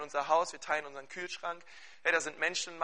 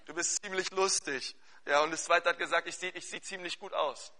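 loud, high, driving sermon voice; fervent preaching; 4 semitones higher, 12 dB louder than usual. Acted. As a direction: preach it out with full force to the congregation. Preaching at full force.

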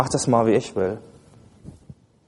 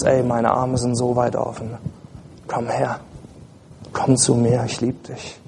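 tender Jesus; 3 semitones lower than usual. Acted. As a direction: gently draw them in. Preacher voices Jesus, tender invitation.

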